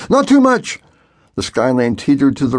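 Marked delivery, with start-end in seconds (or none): 0.1-0.8 much higher, quick warning; urgent caution. A narrator's reading. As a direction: warn him not to overdo it.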